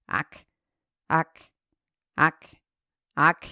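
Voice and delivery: croaked frog calls, low. Acted. Frogs calling out at the pond, croaking. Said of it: "riefen abermals".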